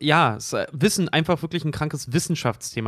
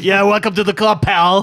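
Rick voice; 5 semitones higher, 7 dB louder than usual. Retold or imitated. Rick answering the robot.

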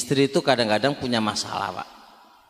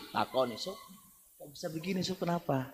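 much softer, friendly question; gentle quiet asking; 13 dB quieter than usual. Shown as a demonstration. Caretaker quietly asking a friend what happened, gentle and soft. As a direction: ask calmly with a cool head. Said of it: "harus dengan kepala yang dingin".

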